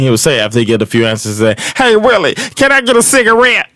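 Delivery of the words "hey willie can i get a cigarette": weird high voice. Retold or imitated. Storyteller imitating the neighborhood man asking about relatives, in a high weird voice. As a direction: squeeze the voice up high and drag it out. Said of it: "he had this weird voice".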